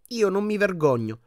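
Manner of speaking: ordinary delivery, nothing marked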